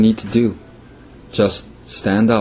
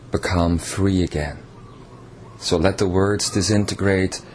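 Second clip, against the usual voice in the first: guided meditation; 3 semitones lower, 5 dB quieter than usual. Acted. Channeled king leading listeners into meditation, soft guiding voice. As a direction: ease the listeners into letting go.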